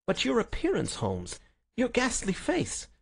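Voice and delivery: high-pitched, dismayed protest; shocked. A narrator's reading. Friend shocked by the detective's appearance, high dismay. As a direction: exclaim high, lingering on the horror.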